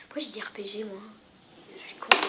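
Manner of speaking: whispered aside to himself; muttered whisper of self-mockery. Real, not acted. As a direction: drop to a whisper, scolding yourself.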